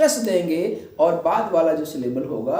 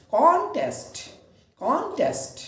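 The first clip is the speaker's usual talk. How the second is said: Contest with CON raised high and strong, test dropping away; stress on first syllable; 6 semitones higher than usual. Shown as a demonstration. Teacher modelling noun stress, contest with stressed first syllable. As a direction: punch the first syllable of contest, let the second fall. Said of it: "इसको हम जो है जोर दें पहले".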